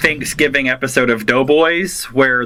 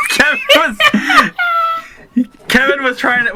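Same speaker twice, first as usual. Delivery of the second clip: laughing. Real, not acted. Laughing through the words.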